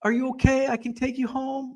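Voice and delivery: high, reassuring old-man impression; imitated kindly old man, pitched high; little old man. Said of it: "he's like".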